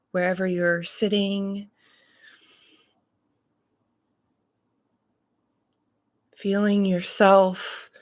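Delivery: unhurried meditation voice, long pauses between phrases; guided meditation. Real, not acted. Reverend leading morning listeners into breath and body awareness, guided meditation. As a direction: guide them calmly, leaving space to breathe.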